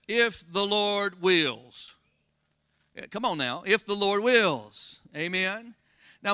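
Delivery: ordinary talk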